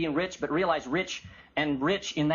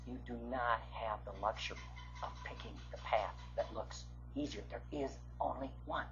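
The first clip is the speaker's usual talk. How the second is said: much softer and lower, weighty; solemn and hushed; 7 semitones lower, 14 dB quieter than usual. Real, not acted. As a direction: drop right down into a hushed, earnest appeal. Solemn hushed appeal to the audience.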